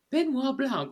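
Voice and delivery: high, loud Southern impression; hammy Southern call; Southern accent. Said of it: "And she's like".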